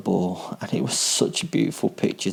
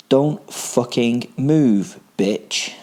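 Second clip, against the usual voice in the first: slow, drawn-out menacing threat; 3 semitones higher, much slower than usual. A narrator's reading. Killer threatening his captive, slow and menacing. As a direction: spit it slowly at her like a predator.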